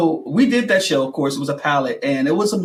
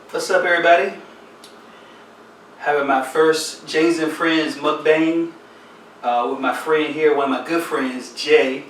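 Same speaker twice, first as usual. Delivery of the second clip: show host intro. Acted. Opens the show for viewers.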